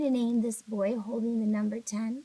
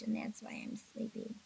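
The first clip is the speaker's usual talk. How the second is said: whispering; 10 dB quieter than usual. Real, not acted. Sleepy aside, whispered.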